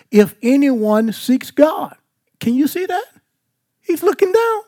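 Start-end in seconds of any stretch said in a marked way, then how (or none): 2.4-4.6 high voice. Real, not acted. Preacher carried away with the class, high voice. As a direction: voice jumps way up high, eager. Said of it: "Y'all make my high voice come out".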